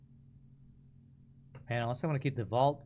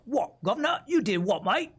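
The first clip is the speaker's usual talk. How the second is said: higher-pitched, hammed-up Cockney; Cockney accent; 7 semitones higher than usual. Shown as a demonstration. Streamer demonstrates what Cockney is, exaggerated Cockney accent. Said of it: "they call Cockney the accent".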